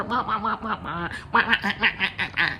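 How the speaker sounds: mock chatter as meaningless wah-wah noise; blah-blah imitation through a laugh. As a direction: mimic someone yapping on and on, laughing. Mimics passenger's droning while she tunes out.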